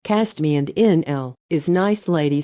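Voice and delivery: ordinary delivery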